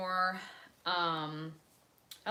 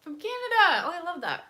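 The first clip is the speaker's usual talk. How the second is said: squealed, far higher and louder; light up at the viewer's message; thrilled shout to a Canadian viewer; excited greeting; 13 semitones higher, 11 dB louder than usual. Real, not acted.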